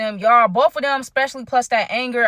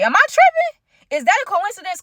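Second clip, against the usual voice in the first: incredulous; 10 semitones higher than usual. Real, not acted. Host asks viewers if she's crazy, high incredulous squeak. Rhetorical disbelief to audience.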